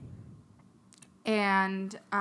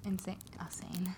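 breathy whispered wordplay; 2 semitones lower, 10 dB quieter than usual. Pretend. Friends whisper the odd word to each other. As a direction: whisper it like a secret.

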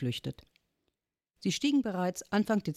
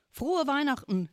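shouting; 10 semitones higher than usual. A narrator's reading. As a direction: call out across the world, much higher. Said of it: "schrie Onkel Max".

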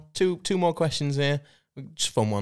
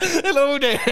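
much higher, louder squeaky Yoda greeting; mock Yoda; 12 semitones higher, 5 dB louder, slower than usual. Co-host repeats the joke Yoda greeting, much higher. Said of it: "Or doing".

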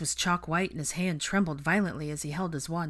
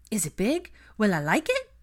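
high, eager little questions; excited child; 6 semitones higher, 6 dB louder than usual. A narrator's reading. A small boy asks his uncle about a surprise, excitedly. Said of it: "Aurelian asked excitedly".

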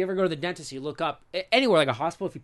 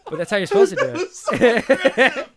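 laughing; 9 semitones higher, 7 dB louder, slower than usual. Real, not acted. Laughing defence after sniffing.

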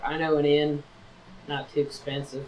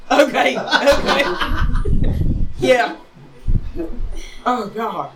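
laughing; 8 semitones higher, 5 dB louder than usual. Real, not acted. Laughing after crude joke.

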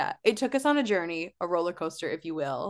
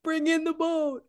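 mimicking Jeff; 8 semitones higher than usual.

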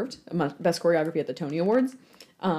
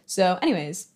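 ordinary delivery

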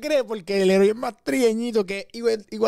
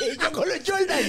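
higher, laughing through the words; 9 semitones higher than usual. Real, not acted. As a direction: push it out between laughs. Joking about his sneakers. (riéndose a carcajadas)

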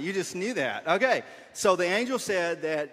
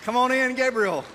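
raised voice, summoning; calling out; 6 semitones higher, 5 dB louder than usual. Real, not acted. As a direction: call him in, louder. Calling the actor on stage.

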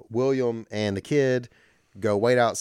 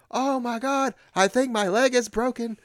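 much higher, whiny poisoned goon; hammed-up squeaky henchman; 14 semitones higher than usual. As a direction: squeak it like a dart just hit you. Fallen henchman after poison dart.

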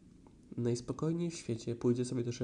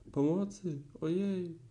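high, louder wail for help from inside a pot; muffled cry for help; 6 semitones higher, 3 dB louder, slower than usual. Acted. Pooh stuck in the pot cries for help, high wailing. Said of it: "jęczał Puchatek z głębi garnka".

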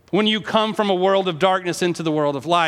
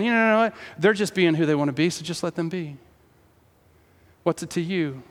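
Satan tempting; 2 semitones lower, 4 dB quieter than usual. Acted. Satan voiced tempting softly.

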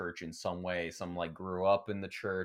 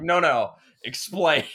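louder mock demand, laughing; retold challenge; 5 semitones higher, 9 dB louder than usual. Retelling pressing a classmate to explain, laughing. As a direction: push back loudly while laughing. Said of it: "and I'm like".

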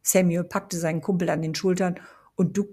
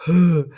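lautes, verdutztes Aufstöhnen; ungläubig aufstöhnen; verblüfftes Hö; 11 dB louder than usual. A narrator's reading. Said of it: "stöhnte Jamie". (Jamie stöhnt verblüfft laut auf)

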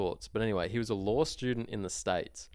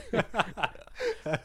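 laughing; 13 semitones higher than usual. Real, not acted. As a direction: crack up at the memory. Laughing at the Viner's joke.

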